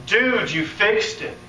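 high, slow, amazed; he can hardly believe it; amazed caller reporting his healing; excited caller. Retold or imitated.

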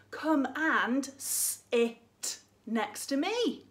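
very slow instruction. Shown as a demonstration. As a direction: say it slowly to a child. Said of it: "So a simple thing like".

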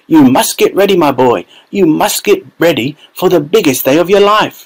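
a thrilled grandpa barking out a list; excited old grandfather. A narrator's reading. Grandfather ordering Charlie to get ready, excited. Grandpa Joe voiced in story.

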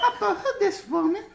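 high laughing woman's call. Retold or imitated. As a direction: squeal the question high. Quoting movie line.

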